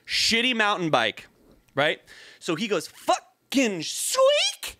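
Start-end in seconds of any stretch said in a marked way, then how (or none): 2.9-4.8 squealed high and drawn out; mock squeak. The kid's bike squealing, imitated high and slow. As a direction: screech it like bike brakes.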